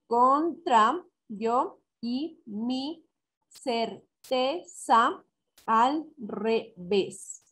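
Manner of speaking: very slow, a gap after every syllable; counting syllables. Shown as a demonstration. Teacher counting the next example verse, syllable by syllable. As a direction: break the line into separate syllables. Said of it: "número de sílabas que conté".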